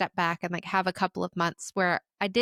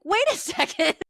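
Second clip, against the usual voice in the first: mock dismay; 10 semitones higher, 4 dB louder than usual. Woman recalls realizing self-care failed at work, mock dismay. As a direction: let disbelief push the voice way up with a laugh.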